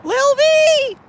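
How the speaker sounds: very high cat-calling voice; high pet call. Real, not acted. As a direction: call the kitten over, high and sweet.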